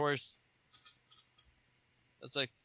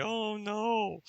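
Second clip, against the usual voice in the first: high, dismayed oh no; worried Nort; 8 semitones higher, 5 dB quieter than usual. Acted. Nort realizing he can't match Hal. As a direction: squeak it out in dismay. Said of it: "It's like".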